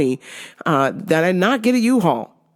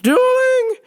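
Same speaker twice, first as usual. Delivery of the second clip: high, drawn-out mock mother; imitated mom; 3 dB louder than usual. Mom objecting to the car plan, high imitation. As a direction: sing it way up high as your mom. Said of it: "She's like".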